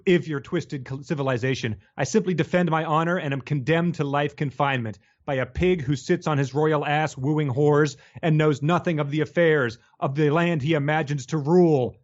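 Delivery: ordinary talk, nothing marked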